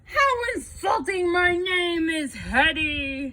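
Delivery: loud, high, offended outcry, slowing at the name; angry monster. A narrator's reading. Monster Hetty offended at being called Yeti, angry. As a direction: bellow the insult back. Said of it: "with the angriest growl".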